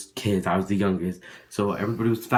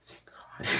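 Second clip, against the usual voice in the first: whispered thank God, barely breathed; relieved whisper; 19 dB quieter than usual. Retold or imitated. Relief after mom takes her side.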